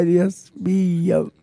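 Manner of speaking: normal talking voice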